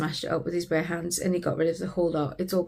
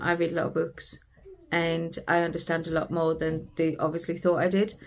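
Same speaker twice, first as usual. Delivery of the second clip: ordinary talk, nothing marked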